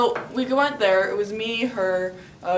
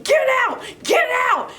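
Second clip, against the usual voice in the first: shrill, high shouted command; screaming at a ghost; 6 dB louder than usual. Retold or imitated. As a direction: yell at the ghost to leave. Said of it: "she starts screaming at it".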